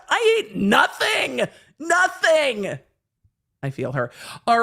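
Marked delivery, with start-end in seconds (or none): 0.1-2.8 high, slow mock shout of I ate nothing; hangry shout. A girl in the car yells at friends who filmed her, high shouted imitation. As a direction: wail it like a starving girl snapping at her friends. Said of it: "She's like".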